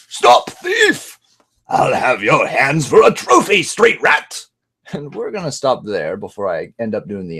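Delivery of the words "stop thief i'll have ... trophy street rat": high loud angry guard; guard shout. Retold or imitated. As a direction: bark it chasing a thief. Guard chasing thief.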